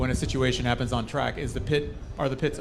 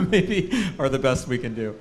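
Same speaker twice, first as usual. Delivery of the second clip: laughing; 3 dB louder than usual. Real, not acted. Joking about clean windows.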